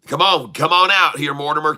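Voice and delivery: normal talking voice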